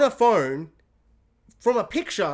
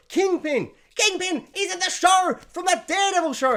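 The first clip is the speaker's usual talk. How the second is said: high-pitched excited cry; hammed-up, high, thrilled exclaiming; excited fan gushing; 9 semitones higher than usual. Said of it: "I'm so excited".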